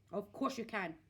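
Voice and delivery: grandma cutting George off; snappish grandma. A narrator's reading. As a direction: snap it back sharply. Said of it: "she snapped".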